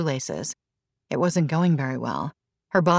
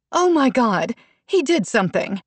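high, louder horrified cry; appalled; 7 semitones higher, 4 dB louder than usual. A narrator's reading. Asha appalled seeing drugged Sloan, horrified cry. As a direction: realize something is wrong.

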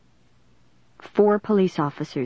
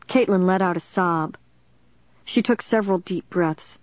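ordinary delivery

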